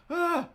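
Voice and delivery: a sudden high, loud cry of frustration; a mock outburst at yourself; frustrated yell. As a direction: let out a short exasperated yelp. Frustrated outburst in a retold moment.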